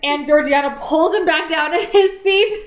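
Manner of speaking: high, laughing through the story; laughing retelling. Real, not acted. Fan laughing while retelling the dinner scene. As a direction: giggle while telling it. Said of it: "retelling a funny scene".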